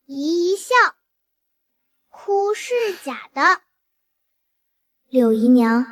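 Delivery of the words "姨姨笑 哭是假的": put-on toddler tattling. Toddler exposes aunt's fake tears.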